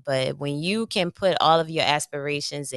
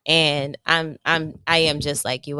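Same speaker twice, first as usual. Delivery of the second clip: ordinary talk, nothing marked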